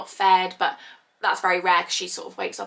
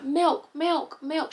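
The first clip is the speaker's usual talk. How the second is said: put-on high toddler calling milk; toddler asking; 7 semitones higher, much slower than usual. Toddler asking mum for milk, high little voice.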